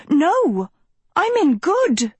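high-pitched indignant shout; shouted protest. A narrator's reading. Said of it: "she shouted".

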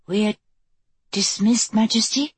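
tentative disbelief. A narrator's reading. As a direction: venture it in mild disbelief. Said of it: "ventured in a tone of disbelief".

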